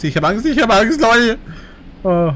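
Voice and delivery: climbing into a frightened high wail; real panic. Real, not acted. Gamer telling viewers he is scared, rising panicked wail. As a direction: let the fear rise until it cracks high and loud.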